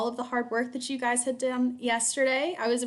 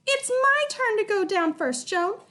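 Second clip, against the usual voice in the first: put-on high loud squabbling kids; children bickering; 10 semitones higher, 3 dB louder than usual. A narrator's reading. Twins argue over who goes first, high squabble.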